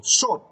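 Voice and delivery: short punched high and loud; stressed syllable alone. Shown as a demonstration. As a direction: hit short sharply on its own. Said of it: "start the first syllable".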